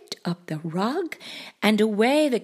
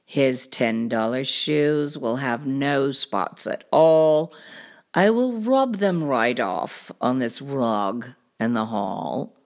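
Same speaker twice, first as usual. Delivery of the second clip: low cartoon cat voice; deep show-off storybook cat; boastful cat; 9 semitones lower than usual. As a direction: explain it deep and unbothered.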